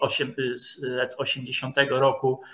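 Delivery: normal talking voice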